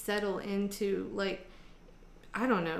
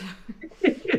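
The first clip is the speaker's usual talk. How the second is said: laugh; 10 semitones higher, 8 dB louder than usual. Real, not acted. Laughing after uncle joke.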